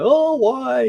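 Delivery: high-pitched, hammed-up wail; mock crying wail. Retelling crying out to a lost brother, mock wailing. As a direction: imitate yourself bawling in a drawn-out high cry. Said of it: "So I'm crying, like".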